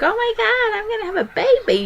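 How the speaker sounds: very high-pitched gushing imitation; mock excited squeal. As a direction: put on an over-the-top thrilled squeal. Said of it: "I wasn't like".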